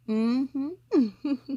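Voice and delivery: usual speaking voice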